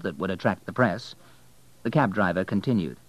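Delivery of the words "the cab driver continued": ordinary delivery, nothing marked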